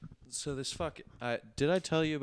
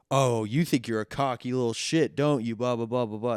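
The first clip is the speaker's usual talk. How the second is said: louder, higher sneering caller; mocking caller; 3 semitones higher, 7 dB louder than usual. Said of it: "And he goes".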